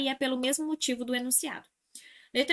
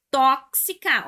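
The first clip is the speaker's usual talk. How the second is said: Tóxica with TÓ hit loud and drawn out; stress on the antepenultimate; 2 semitones higher, 6 dB louder, much slower than usual. Shown as a demonstration. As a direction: punch the first syllable of Tóxica. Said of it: "antepenúltima".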